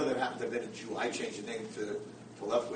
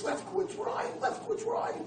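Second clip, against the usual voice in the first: screaming for his friend. Retold or imitated. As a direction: call out desperately into the dark. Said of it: "And he starts screaming".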